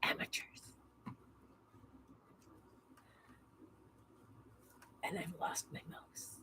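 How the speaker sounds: muttered whisper to herself. Real, not acted. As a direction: whisper it under your breath.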